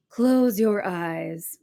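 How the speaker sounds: very slow, soothing therapist; therapist's calm voice. A narrator's reading. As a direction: draw it out like a calming guide. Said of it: "she said".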